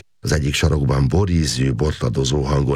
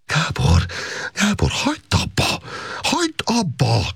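crying out. A narrator's reading. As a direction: cry out to make him stop. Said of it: "kiáltotta Szolga Erzsébet".

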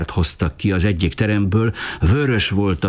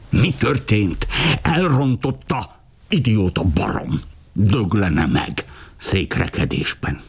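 cursing outburst; 4 semitones higher than usual. A narrator's reading. Tia curses the king.

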